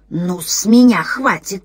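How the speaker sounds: slow, dry, dead-sounding rustle; dead rustling voice. Acted. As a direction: rustle it out like a corpse. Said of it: "прошелестел мёртвый голос".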